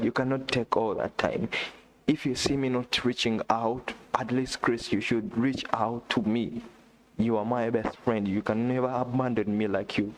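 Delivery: normal talking voice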